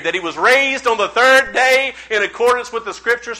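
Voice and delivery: high, loud scripture from memory; excited recital. Retold or imitated. Excited preacher answering the Jehovah's Witness by heart, excited recital. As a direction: rattle off the verse with rising excitement. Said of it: "I was so excited".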